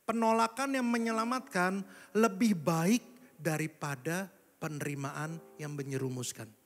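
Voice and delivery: slow, weighty restatement, stressing each phrase; slow emphatic repeat. Shown as a demonstration. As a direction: lay it down slowly like a key verse. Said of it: "Saya ulang sekali lagi ya".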